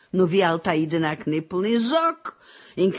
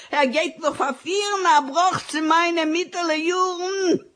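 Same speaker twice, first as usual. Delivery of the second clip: wailing lament; 9 semitones higher than usual. Acted. Widow lamenting stolen ring.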